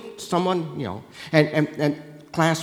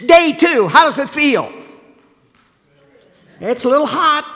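mock complaint; 7 semitones higher, 7 dB louder than usual. Role-playing the journey day by day.

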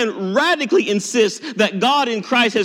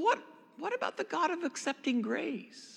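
usual speaking voice

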